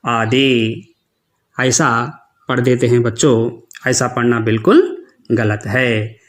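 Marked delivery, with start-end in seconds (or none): none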